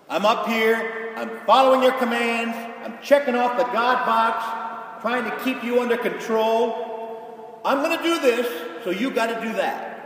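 mock a box-checker bargaining with God; dutiful believer. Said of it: "some folks do think of God that way".

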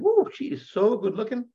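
high, smitten whoop; charmed men. Acted. The soldiers react to charming Judith, smitten mock whoop. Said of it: "she's charming them. And, and they said".

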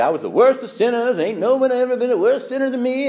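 high, loud mock revival testimony; over-the-top preacher confessing sins; exaggerated testimony. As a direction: ham up a sinner's boast from the pulpit. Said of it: "some of the Protestant churches exaggerate".

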